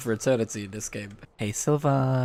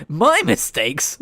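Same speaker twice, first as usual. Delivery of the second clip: louder, slow, offended question; indignant disbelief; 4 semitones higher, 7 dB louder, much slower than usual. Real, not acted. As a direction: throw the accusation back. Indignant reply to game line.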